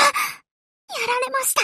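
ordinary talk, nothing marked

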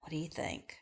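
ordinary delivery

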